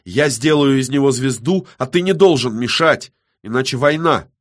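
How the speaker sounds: loud, high, combative declaration; bared-teeth threat. A narrator's reading. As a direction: lay down the ultimatum with force. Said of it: "Иола ощерилась".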